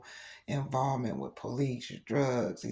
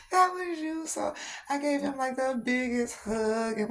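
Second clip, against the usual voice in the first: much higher, louder squeal of delight; overjoyed surprise; 12 semitones higher, 3 dB louder than usual. Real, not acted. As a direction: squeal it like the surprise just hit you. Realizing the son sent the doll.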